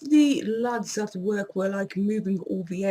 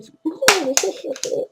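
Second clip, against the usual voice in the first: laughing; 7 semitones higher, 7 dB louder than usual. Real, not acted. Laughing at sour taste.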